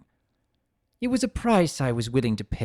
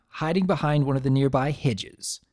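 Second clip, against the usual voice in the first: ordinary delivery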